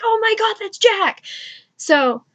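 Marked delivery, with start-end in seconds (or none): 0.0-1.2 high-pitched excited squeal of recognition; mock fangirl excitement, pitched way up; excited fan. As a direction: imitate a thrilled fan spotting an idol. Crowd reacts to spotting Jack.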